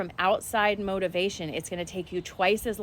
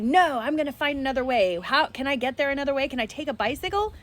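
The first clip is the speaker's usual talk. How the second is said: raised, higher frustrated protest; retold angry yell; 6 semitones higher than usual. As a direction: replay the fit you threw at the ferry. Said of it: "I was yelling and angry".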